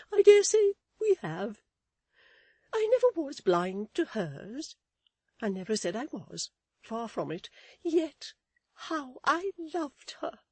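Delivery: high, loud wailing protest; acted weeping. A narrator's reading. Mrs Chick weeps over poor Fanny, acted weeping. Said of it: "weeping and shaking her head".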